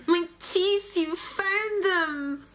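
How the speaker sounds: high, slow tearful joy; overjoyed. Acted. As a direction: gush with tearful delight. Gasp, joyful cry, high.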